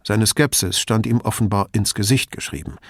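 ordinary talk, nothing marked

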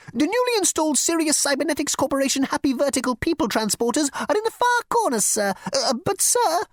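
high, fussy insect voice; insect receptionist. Acted. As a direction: squeak it like a prissy bug.

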